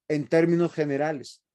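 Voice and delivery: ordinary talk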